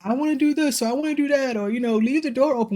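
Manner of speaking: a hint of a crying two-year-old begging; imitating whiny toddler. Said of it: "making these pleadings of".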